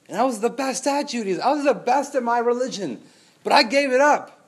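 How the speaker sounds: Paul defending himself against his critics; Paul's indignant boast. Acted. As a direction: press the point with rising passion. Paul voiced, indignant about Judaism.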